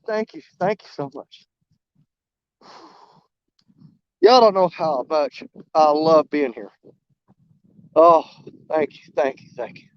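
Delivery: real emotion catching in the throat; choked-up thanks. Real, not acted. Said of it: "I'm so emotional today".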